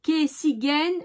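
slow, halting word-by-word reading; slow and deliberate, feeling out each syllable; careful foreign-phrase reading. Real, not acted. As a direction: sound out an unfamiliar regional phrase. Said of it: "avec mon accent, c'est, c'est pas".

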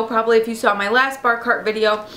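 usual speaking voice

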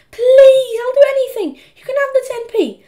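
high, loud, tearful plea; desperate begging. A narrator's reading. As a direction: beg your brother in a squeaky wail. Peter begs Henry for rescue.

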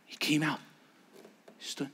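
hushed, unvoiced storytelling; whispered narration. A narrator's reading. Preacher telling the mountain story, whispering. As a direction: keep the hush going.

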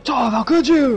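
high-pitched, hammed-up accusation; mock reproach. Acting Aang's reproach to Toph.

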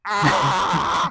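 loud, high yell of "ah"; mock yell. Player yelling at a game's microphone, mock yell. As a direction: yell it straight into the mic. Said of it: "couldn't you just be like".

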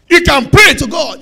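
loud, high preaching cry; fiery pulpit shout. Acted. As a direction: shout it over the music. Preacher shouting over music.

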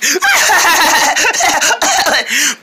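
high manic cackling; crazy laugh. Acted. Friend says he's acting crazy, manic laughing. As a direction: laugh like you've lost it. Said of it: "He's acting all crazy like".

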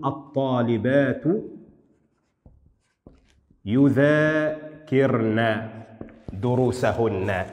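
very slow, يذاكرن drawn out as "yudhaa-kirna"; slow dictation, pause before يذاكرن, endings stretched; feminine plural nun. Shown as a demonstration. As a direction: sound out يذاكرن and دروسهن, dwelling on the final "-na". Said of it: "وإنما هي نون نسوة".

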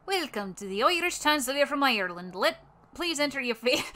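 Irish sliding up into Scottish; attempted Irish accent. Reading a translator page aloud, wobbly Irish accent. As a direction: put on a lilting, badly faked Irish accent. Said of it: "slides up into a fucking Scottish accent".

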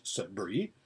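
plain reading voice

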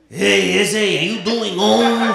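high, loud LA California street accent, hammed up; mock LA California homeboy greeting in a California accent. Comic mocking LA locals to the crowd, in a loud, high California street accent. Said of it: "the California accent's a little bit more like".

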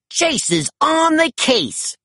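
high, slow catchphrase call; mild shout. A narrator's reading. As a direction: announce himself proudly. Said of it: "Chase shouted".

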